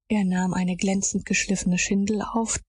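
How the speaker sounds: ordinary delivery, nothing marked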